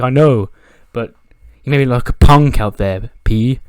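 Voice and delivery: heated complaint. Retold or imitated. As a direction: vent the grudge loudly to a friend. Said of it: "Bobby Lashley's heated".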